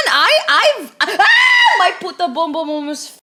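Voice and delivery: real surprised shout; startled shout. Real, not acted. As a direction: yelp as something nearly falls. Food nearly falls, startled.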